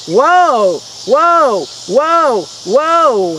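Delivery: high, loud whoas, each one falling; amazed whoas. Acted. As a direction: act out one astonishment after another. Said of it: "That's what you should be constantly doing".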